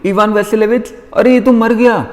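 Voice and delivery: high panicked cry of his name; screaming. Acted. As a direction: shriek it out in alarm. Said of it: "और वो चिल्लाती है".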